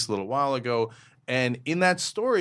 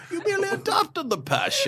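put-on movie villain voice, pitched far up; hammed-up villain impression through laughter; Bane. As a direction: parody a villain's grand line. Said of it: "as Bane would say".

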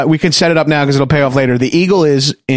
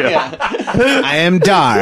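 laughing mock Dar; 3 semitones higher, much slower than usual. Dar riff, slowed by laughter.

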